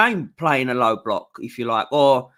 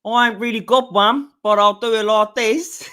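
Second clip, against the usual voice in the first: put-on Australian accent; 3 semitones higher than usual. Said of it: "give us your best Australian accent".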